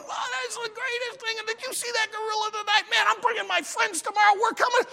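high, fast gushing spectators; excited crowd. Acted. Circus-goers raving about the gorilla act, high and excited. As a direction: squeal like amazed circus fans. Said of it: "people are yelling and screaming".

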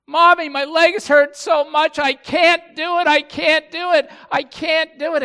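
very high, very loud mock child's wail; hammed-up childish shouting through tears; imitated crying child. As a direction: bawl it out like an exhausted little girl. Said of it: "you know, she's crying".

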